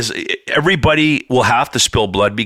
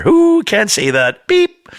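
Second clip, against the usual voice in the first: very high loud beep; mock censor beep; 10 semitones higher, 3 dB louder, slower than usual. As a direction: imitate a bleep. Censor bleep joke.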